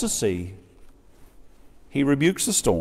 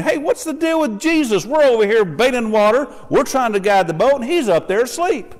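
raised, fast, exasperated complaint; a frightened crew snapping at a sleeping leader; high and fast, mock indignant; mad disciples; 10 semitones higher, 4 dB louder than usual. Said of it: "they're probably a little mad at him".

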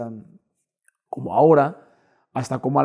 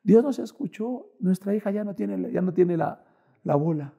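tearful wife; 3 semitones higher, 6 dB quieter than usual. Retold or imitated. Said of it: "Me habla mi esposa con lágrimas".

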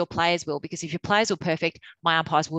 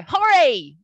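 bright loud called greeting, pitched up; cheery call; 8 semitones higher, 9 dB louder than usual. Retold or imitated. Umpires manager calling out to the coach in greeting, cheery call. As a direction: call his name across the field.

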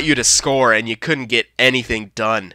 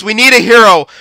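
ordinary delivery

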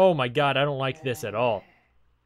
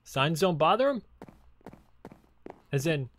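ordinary talk, nothing marked

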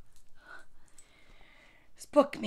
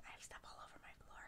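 quick, very soft whisper; hushed aside; 32 dB quieter, much faster than usual. Real, not acted. Storyteller confessing own mess to the kids, whispered. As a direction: mutter it fast under your breath.

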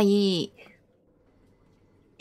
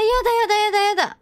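whining; 9 semitones higher, much faster than usual. Real, not acted. Stuck searching for anomaly.

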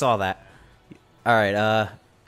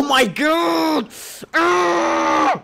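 drawn-out shout rising into a scream; exasperated shout; 5 semitones higher, much slower than usual. Real, not acted. Player watching Arnold's play go wrong, exasperated scream. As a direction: cry out slowly, then scream. Reacts to a miss in game.

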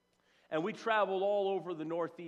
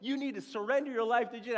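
mock street preaching; 8 semitones higher, 4 dB louder than usual. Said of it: "I start preaching".

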